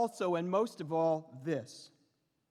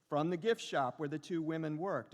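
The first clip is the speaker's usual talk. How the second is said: usual speaking voice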